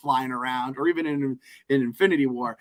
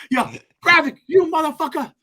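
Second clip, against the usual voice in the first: taunting Nick Fury; 11 semitones higher, 3 dB louder than usual. Retold or imitated.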